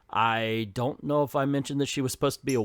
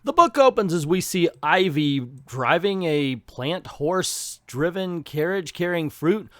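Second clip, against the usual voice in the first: ordinary talk, nothing marked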